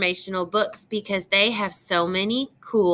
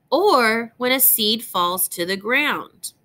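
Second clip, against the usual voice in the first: normal talking voice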